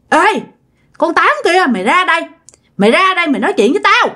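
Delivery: high-pitched, rushed angry summons; cursing call-out. Acted. Drunk husband at the neighbour's door, angrily calling her out. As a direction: call her out, picking a fight. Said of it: "ổng mới chửi".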